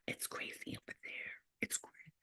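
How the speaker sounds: hushed, breathy secret; whisper. Real, not acted. Warning viewers about Cali, dropped to a whisper.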